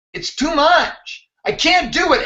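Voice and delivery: high, slow, pleading, as if at the end of his rope; despairing inner voice. Retold or imitated. Exhausted runner at one in the morning, high, slow and despairing. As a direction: let the voice climb and stretch out, ready to quit.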